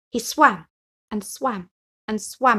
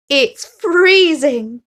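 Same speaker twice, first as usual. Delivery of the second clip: shivering complaint; 7 semitones higher, 11 dB louder, much slower than usual. Acted. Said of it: "he gasped".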